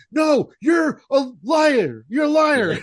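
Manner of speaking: high, drawn-out mock yell; mock furious shout. Retelling a wrestler's repeated accusation, high mock shout. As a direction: yell it slowly, imitating an outraged wrestler.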